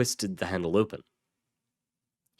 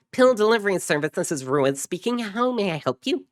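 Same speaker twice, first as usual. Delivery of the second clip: very high, fast singsong phone greeting; mock receptionist answering a call; put-on customer service voice; 12 semitones higher, 4 dB louder than usual. Ruiz mockingly answering his brother's radio, high service voice.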